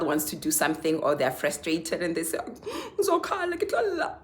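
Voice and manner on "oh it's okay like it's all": put-on high, teary child's whine broken by laughs; half-laughing, put on a whining kid; whiny child. Imitating a frustrated child to God, high, teary, laughing. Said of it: "like a child".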